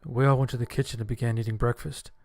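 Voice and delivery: plain reading voice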